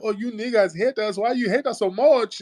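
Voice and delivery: high, whining imitation of callers; mock their wounded protest way up high; mock complaining caller. Host mimicking callers who say they are hated, mock complaint. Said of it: "Y'all calling up".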